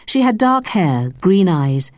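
plain reading voice

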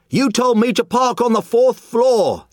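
high frantic scream at his wife; shrill panicked shout, pitch way up. Acted. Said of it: "the man screamed".

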